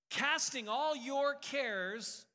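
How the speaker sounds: drawn-out, loud and high; slow emphatic. Acted. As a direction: stretch every word.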